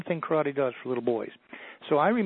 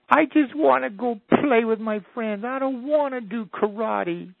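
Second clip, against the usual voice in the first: put-on high, slow, tearful little boy's plea; crying child; 8 semitones higher, 6 dB louder, slower than usual. Little boy in karate class blurting out his wish through tears. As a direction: blurt it out through tears like a six-year-old. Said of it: "now he's really crying".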